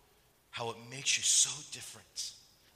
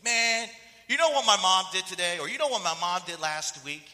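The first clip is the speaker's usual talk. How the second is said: loud, high-pitched mock complaint; worked-up imitation of an aggrieved son; indignant griping; 6 semitones higher, 10 dB louder than usual. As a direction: act out a bitter friend venting. Friend's bitter complaint about mom, acted.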